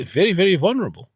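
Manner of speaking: very, very vulnerable pushed up high and loud; urgent rising emphasis on "very, very vulnerable"; emphatic very very. Real, not acted. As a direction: lean hard on "very, very". Stressing how exposed people are.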